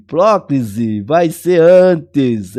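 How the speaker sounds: "próclise vai ser antes" dragged out, stress on antes; slow emphatic rule. Shown as a demonstration. Teacher stating the próclise rule slowly to the class. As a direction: stretch it and land hard on "antes". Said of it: "Então, próclise".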